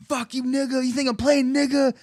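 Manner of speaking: high-pitched mock aggressive yell; imitated angry attacker.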